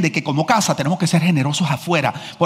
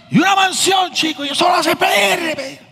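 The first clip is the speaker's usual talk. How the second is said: mocking Cuban man; 11 semitones higher than usual. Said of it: "lo dije con las sílabas y todo". (burla aguda y exagerada contra el pastor)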